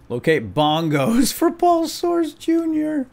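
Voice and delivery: high, slow giggling read; laughing. Real, not acted. Amused player reading a silly quest, giggling. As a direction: giggle through the quest name.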